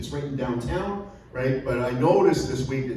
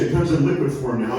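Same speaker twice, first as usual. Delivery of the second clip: ordinary talk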